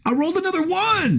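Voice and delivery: high, fast outburst of disbelief; real disbelief. Real, not acted. Player tells table the reroll failed, high incredulous cry. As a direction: cry out in exasperated amazement, pitch way up.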